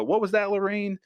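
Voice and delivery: high-pitched mock alarm; imitated alarmed question. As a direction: imitate a rattled movie character. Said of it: "see him go".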